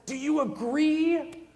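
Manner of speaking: drawn-out mock question. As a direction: drag out the question lazily, for a laugh. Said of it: "we're just like".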